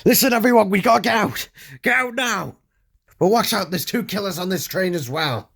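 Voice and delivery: very high, loud yelling to clear the train; top-of-the-lungs yell. A narrator's reading. Passenger warning everyone about a fire and killers, yelling. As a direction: yell an urgent alarm to everyone aboard. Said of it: "I yelled out at the top of my lungs".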